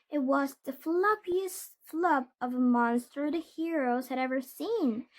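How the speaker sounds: ordinary delivery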